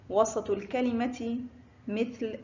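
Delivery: ordinary talk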